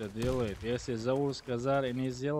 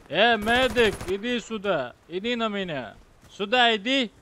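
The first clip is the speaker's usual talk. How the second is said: loud urgent call; shouting for medic; 9 semitones higher, 8 dB louder than usual. Real, not acted. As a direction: yell across the map for the medic. Calling medic in fight.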